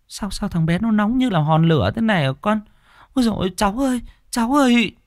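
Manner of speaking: high-pitched wailing alarm; alarmed grandmother. A narrator's reading. Grandmother clutching her feverish grandchild, high alarmed wail. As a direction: cry out in dismay over the child. Grandmother finds the child burning hot.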